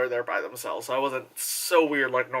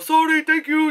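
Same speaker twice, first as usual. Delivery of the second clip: high, louder apologetic impersonation; Jackie Chan; 11 semitones higher, 5 dB louder than usual. Acted. Imitating the actor's habitual apology, high impersonated voice. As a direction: pitch it up into a polite movie-star imitation. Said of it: "the very Jackie Chan of".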